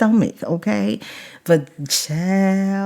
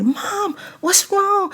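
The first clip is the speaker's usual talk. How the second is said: worried daughter; 13 semitones higher, slower than usual. Retold or imitated. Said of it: "Nadine was like".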